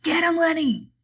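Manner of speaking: very high, loud yell through cupped hands. A narrator's reading. George yelling to Lenny across the room. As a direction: bellow it across the fight. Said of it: "cupped his hands ... and yelled".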